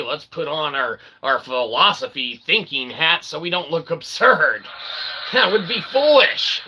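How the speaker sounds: ordinary talk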